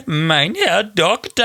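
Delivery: slow, high hammed-up German accent; mock German accent. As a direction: ham up a cartoon German accent, drawn out. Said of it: "the accent was the gag".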